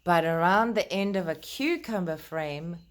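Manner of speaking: put-on gentle, lilting read-aloud to children; storybook reading. Reading Peter Rabbit aloud to the children, gentle storytelling voice.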